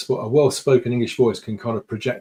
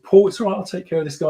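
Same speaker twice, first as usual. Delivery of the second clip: louder, higher reassuring manager; manager's voice; 6 semitones higher, 4 dB louder, faster than usual. Retold or imitated. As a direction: step in and take charge kindly. Manager steps in to help.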